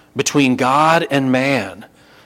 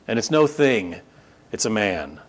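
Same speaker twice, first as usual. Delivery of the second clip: ordinary delivery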